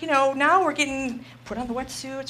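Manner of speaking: usual speaking voice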